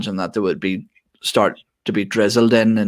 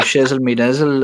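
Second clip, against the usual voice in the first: laughing mock Irish accent, higher; 5 semitones higher than usual. Shown as a demonstration. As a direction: try it in an Irish lilt. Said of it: "I can't say that in an Irish accent".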